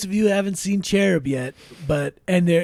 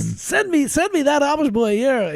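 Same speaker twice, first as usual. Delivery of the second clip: demanding shout; 8 semitones higher, 3 dB louder than usual. Acted.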